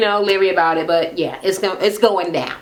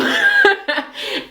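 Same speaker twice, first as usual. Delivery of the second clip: high laugh. Real, not acted. Telling grandma story, bursts into high laugh. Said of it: "laughing at own story".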